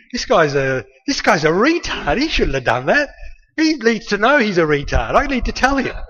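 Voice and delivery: loud, high, exasperated protest; indignant inner rant. Retold or imitated. Narrator itching to lecture his brother, indignant. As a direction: build to a high, worked-up insistence.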